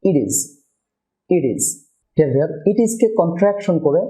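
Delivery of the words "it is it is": "it is" run together as "idiz"; quick D for the T in "it is"; flap T. Shown as a demonstration. Teacher repeats the rule's result for viewers, flap T. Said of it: "flat টি বা quick ডি হবে".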